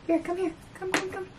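soft high-pitched pet call; calling a cat. Real, not acted. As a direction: beckon a pet in a small high voice.

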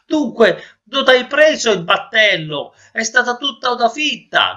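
high, loud stuffed-nose protest; indignant shout. Acted. Noseless man confronts his sailing nose, shouting high. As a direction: shout accusingly with a blocked nose. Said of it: "gridò quel signore".

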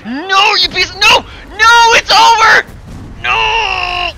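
very high, very loud drawn-out no; despairing screams. Real, not acted. Gamer screaming as his army is wiped out, despairing. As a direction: scream as it all falls apart. Losing the battle.

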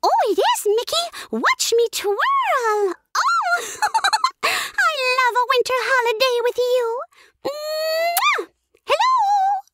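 very high, squeaky, sweet cartoon mouse voice; Minnie Mouse. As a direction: go way up into a giggly, adoring cartoon sweetheart. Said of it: "And then Minnie goes".

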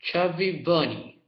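talking with a full mouth; cheeks stuffed full. Real, not acted. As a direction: say it through cheeks full of marshmallows. Mouth crammed with marshmallows.

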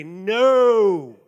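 loud, drawn-out NO; big booming rejection; emphatic no. Shown as a demonstration. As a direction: cry out a forceful refusal. Rejecting riling others up to anger.

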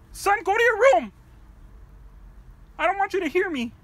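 loud, high barked order to leave; furious dad roaring at his son to go; yelling at son. Acted. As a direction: shout the kid out of the room. Father shouting son out of room.

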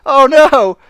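high, loud wail breaking out of laughter; real loud, high exclamation with a laugh; amused dismay. Real, not acted. Reacts to turtle missing legs.

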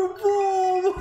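normal talking voice

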